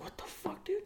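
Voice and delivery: high, hushed whisper of panic; scared whisper. Retold or imitated. Hiding from an arguing couple, panicked whisper. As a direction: whisper it, terrified of being heard.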